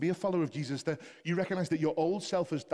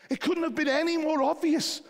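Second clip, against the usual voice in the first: very high, loud exclamation; full-force preaching; 11 dB louder than usual. Real, not acted. Preacher exclaiming over the Romanian first convert, full-force preaching. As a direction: let it burst out at the top of the voice.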